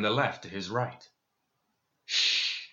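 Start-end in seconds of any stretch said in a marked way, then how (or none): 2.0-2.7 sharp urgent shush; commanding shush. Acted. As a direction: hush him with a firm shh. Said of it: "he commanded".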